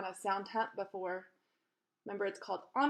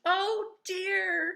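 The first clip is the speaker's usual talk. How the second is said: high, loud cry of dismay; 10 semitones higher, 5 dB louder than usual. Acted. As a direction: wail it out, up high. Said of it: "he cried".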